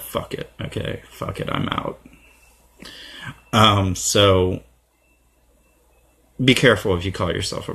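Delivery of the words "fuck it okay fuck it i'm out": soft fed-up aside; quietly, half to himself; muttered. Real, not acted. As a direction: mutter it under your breath, giving up.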